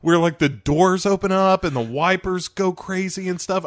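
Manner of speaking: ordinary talk, nothing marked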